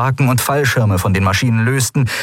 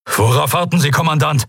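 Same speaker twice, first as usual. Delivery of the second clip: raised, higher commanding voice; imperious rebuke; 7 semitones higher than usual. A narrator's reading. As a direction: snap at a subordinate officer. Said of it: "herrschte Telios einen ersten Offizier an". (herrisch angefahren, Stimme angehoben)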